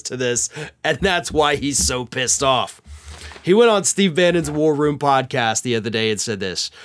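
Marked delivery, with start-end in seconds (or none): none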